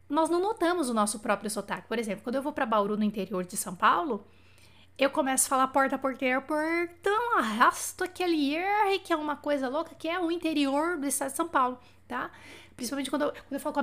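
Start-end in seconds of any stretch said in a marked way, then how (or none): none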